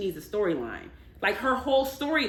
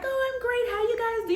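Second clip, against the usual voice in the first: much higher, sing-song fake-polite chirp; chirpy put-on friendliness, pitched way up; fake small talk; 13 semitones higher than usual. Castmates trading polite small talk, in a much higher fake-cheery voice. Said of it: "everyone's like".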